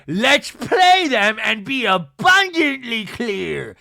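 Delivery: loud, slow, affected declaration; Meredith impression. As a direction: draw it out with mock grandeur. Mimics Meredith's slow retort.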